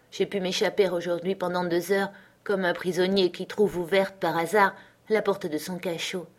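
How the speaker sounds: ordinary delivery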